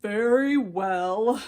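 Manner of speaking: slow, loud, resigned; drawn-out reluctant agreement; slow weary elephant yielding; elephant giving in. A narrator's reading. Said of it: "said the elephant".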